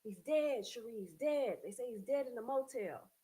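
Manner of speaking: high, loud anguished scream. Retold or imitated. Father screaming to the mother that their son died. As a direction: scream the news in panic. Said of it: "Dad called me screaming".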